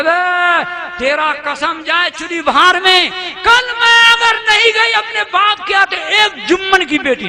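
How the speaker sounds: nagging wife's loud retort; quarrelsome wife. Acted. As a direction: snap back at the husband. Quarrelsome wife scolding husband.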